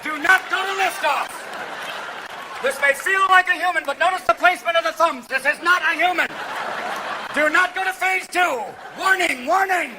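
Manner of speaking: alarm warning. Acted. Brain warning the body off the gorilla, shouting. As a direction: shout it like a mission control alarm.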